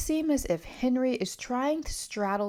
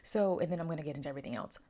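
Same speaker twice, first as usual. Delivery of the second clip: usual speaking voice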